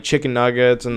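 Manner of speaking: normal talking voice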